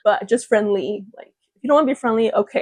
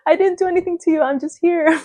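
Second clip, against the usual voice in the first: higher, laughing puzzled why; puzzled plea; 6 semitones higher than usual. Retold or imitated. Vlogger asking coworkers why they dislike her. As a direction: plead innocently with a laugh. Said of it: "I'm like".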